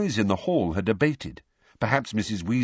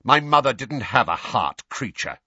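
loud, raised, cutting retort; snapping; 6 semitones higher, 5 dB louder than usual. Acted. Master retorting to the elf about his mother, snapping. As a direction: snap back at the insult. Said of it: "snapped Sirius".

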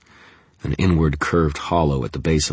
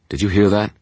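high, tense and alert; alert question; 6 semitones higher, 6 dB louder than usual. Acted. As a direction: call out on edge. Guard alert to noise.

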